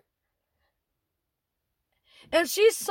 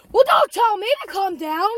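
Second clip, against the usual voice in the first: super mad; 3 semitones higher, 6 dB louder than usual. Retold or imitated. Customer gets super mad at manager, angry raised voice. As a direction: act out a furious customer snapping back. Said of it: "she gets super mad".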